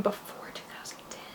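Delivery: 轻声耳语重复"before two thousand ten"，几乎无声的气声; whisper. Real, not acted. Rereads prompt to herself.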